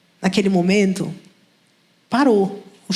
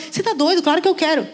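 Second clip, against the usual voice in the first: much higher pitch, incredulous retort; indignant disbelief; 7 semitones higher, faster than usual. Retold or imitated. Woman answering friends who doubt her, incredulously higher. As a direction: scoff at the suggestion.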